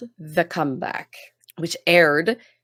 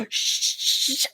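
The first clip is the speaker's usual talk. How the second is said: obnoxious forced shush; 4 dB quieter than usual. Retold or imitated. Lorelai shushing the noisy guy at movie night, in a forced whisper. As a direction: shush the whole crowd so everyone hears. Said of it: "Lorelai's obnoxious shushing".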